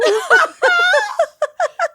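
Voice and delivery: high squealing laugh; high giggle. Real, not acted. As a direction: break into a shrieking giggle. Giggling during the silly song.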